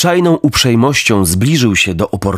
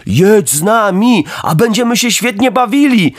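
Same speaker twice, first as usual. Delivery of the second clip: put-on a hundred boys shouting together; mock crowd yell; yelled chorus; 9 semitones higher than usual. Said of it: "wrzasnęło chórem ze sto głosów".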